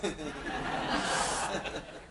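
silent shaking laugh; real amused laugh; breathy laugh. Real, not acted. Laughs after Bible joke.

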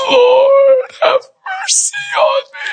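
high, wailing plea for mercy; crying out. Acted. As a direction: cry out like a desperate, pleading mother. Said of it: "She's crying out".

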